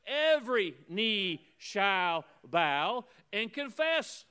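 slow and loud, each word driven home; preacher's drawn-out, ringing proclamation; emphatic scripture declamation. Real, not acted.

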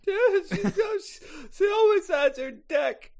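high-pitched sobbed answer; tearful. Acted. Mother answering questions about the girlfriend, tearful. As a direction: answer through hammed-up crying.

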